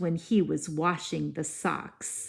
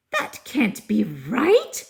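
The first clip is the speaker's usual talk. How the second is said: mild fairy worry; 4 semitones higher, 4 dB louder than usual. Acted. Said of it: "said the fairy".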